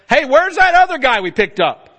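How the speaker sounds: loud, high alarmed call; crewman calling out. Acted. Sailor on deck shouting for the missing passenger. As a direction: yell across the deck for the missing guy. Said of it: "somebody says".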